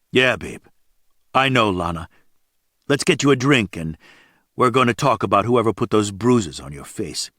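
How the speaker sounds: usual speaking voice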